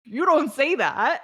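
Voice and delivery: a touch of high scolding; mock scolding mom. Mom scolds her toddler for swearing, high and half-laughing. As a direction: scold the kid while trying not to laugh. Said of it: "And I was like".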